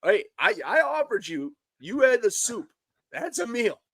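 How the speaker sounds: squeaky, high defensive rant; high-pitched protest. Real, not acted. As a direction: pitch shoots up, indignant. Defending his soup dinner, heated.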